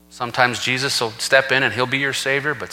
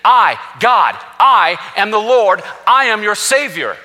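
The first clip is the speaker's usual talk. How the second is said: high, loud, slow scripture proclamation; full-force preaching; 8 semitones higher, 5 dB louder than usual. Acted. Preacher quoting Isaiah to the congregation, full-force preaching. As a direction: ring it out as God's own declaration.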